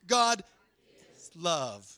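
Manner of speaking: God rings out loud, then is love settles; loud call and response. Real, not acted. Preacher leading the congregation, loud on God. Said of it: "Say it with me one more time".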